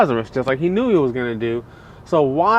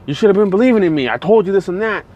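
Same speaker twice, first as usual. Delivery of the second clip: higher, louder, rushed scolding; mocking scold; 6 semitones higher, 4 dB louder, much faster than usual. An uncaring God scolding the doubters, higher and faster. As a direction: fire off a taunting told-you-so. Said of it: "You know, like".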